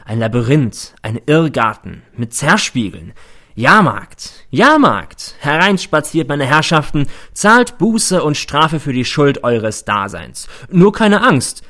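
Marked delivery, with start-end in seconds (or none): 3.6-5.3 higher and louder, crying out the fair; a feverish carnival crier; fairground barker call. A narrator's reading. 5.4-11.6 loud showman invitation, slow on the penance line; a bitter hammed-up carnival spiel; fairground barker. A narrator's reading.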